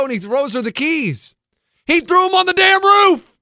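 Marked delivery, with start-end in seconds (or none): 1.9-3.3 high, loud disbelief; incredulous shout. Real, not acted. Son shouts to his friends that his dad threw the keys on the roof. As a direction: shout it out like you still can't believe it.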